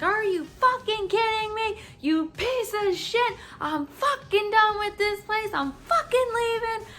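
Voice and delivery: strained mock screaming tirade; screaming meltdown. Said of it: "and I'm screaming".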